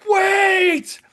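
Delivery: a high, loud cry of wait; desperate shout. Retold or imitated. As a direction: yell it to stop him in time. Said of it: "He goes".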